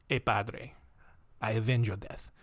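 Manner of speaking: softly spoken mock Spanish accent; Antonio Banderas. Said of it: "apparently it's still Antonio Banderas".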